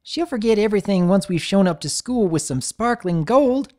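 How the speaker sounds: bright grin. A narrator's reading. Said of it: "remarked with a bright grin".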